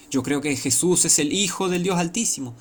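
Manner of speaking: lightly acted outcry of belief; mock proclamation. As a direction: declare it high, imitating a false believer. Voices a possessed man professing faith.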